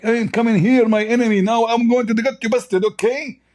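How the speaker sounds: high, taunting mock voice of the rival; lightly hammed-up sneer at his enemy; mocking gloating opponent. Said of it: "he was like".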